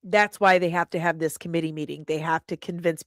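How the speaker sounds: ordinary delivery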